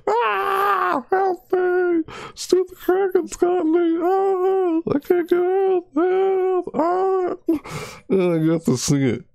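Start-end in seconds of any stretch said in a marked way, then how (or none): 0.1-7.3 hammed-up, very high falsetto screams; screaming my head off. Streamer re-enacting being caught by the Kraken, high mock screaming. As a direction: shriek in mock panic, pitched way up. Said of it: "I'm, like, screaming my head off".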